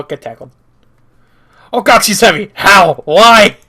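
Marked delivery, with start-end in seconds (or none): 1.7-3.6 squashed yelling. Acted. Hero is crushed by Penny's hug, yelling in strain. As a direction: shout as if crushed under a hug.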